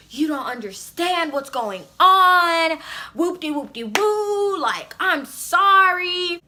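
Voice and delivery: high, stretched-out mock pleading; imitated boyfriend. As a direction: mimic his excuses, dragging the words. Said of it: "he was like".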